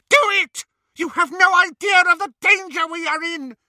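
high, frantic shrieking. A narrator's reading. Bellatrix overruling her sister in panic, shrieking. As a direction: shriek it like someone gone mad. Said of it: "shrieked Bellatrix".

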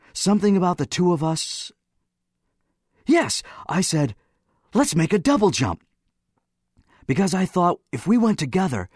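high, eager, happy recollection. A narrator's reading. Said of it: "He was very happy".

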